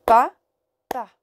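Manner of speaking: "Pa, pa" détaché, une pause entre les deux syllabes; papa en syllabes. Shown as a demonstration. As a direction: frappe chaque syllabe séparément. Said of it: "on ferait comme ça". (papa syllabe par syllabe)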